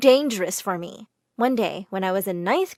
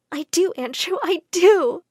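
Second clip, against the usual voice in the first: lovestruck, higher and louder; swooning; 7 semitones higher, 4 dB louder than usual. Acted. A girl answering her crush in a daydream, swooning. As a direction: gush it like a smitten girl.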